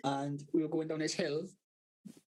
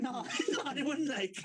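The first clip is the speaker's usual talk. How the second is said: laughing through the words, higher; real laughter; 10 semitones higher than usual. Real, not acted. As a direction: giggle as you protest. Laughing at own reply.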